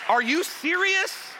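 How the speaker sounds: high-pitched, drawn-out incredulity; hammed-up indignant question; mock disbelief. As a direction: stretch it out, appalled. Mock outrage at pineapple pizza.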